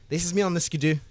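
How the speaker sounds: usual speaking voice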